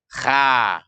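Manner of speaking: KHA thin, with an open "a"; wrong thin kha. Shown as a demonstration. Teacher shows learners the mistaken reading, thin kha. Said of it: "Yang salah bagaimana".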